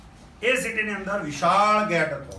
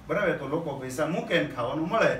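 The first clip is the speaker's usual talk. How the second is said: ordinary talk, nothing marked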